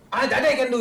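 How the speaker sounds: high, delighted agreement; acted eager boy. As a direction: jump at it with relief.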